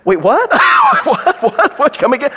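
high, loud mock double take; stunned disbelief. Preacher imitating young Greg Laurie, stunned. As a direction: ask it again and again in shock. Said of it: "And he went".

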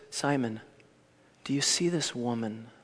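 soft, low, gentle question; warm and tender. Acted. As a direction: ask it with tenderness and no judgment. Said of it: "Can you hear the warmth of Jesus' words?".

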